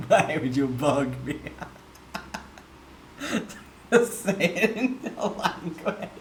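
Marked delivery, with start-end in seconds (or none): none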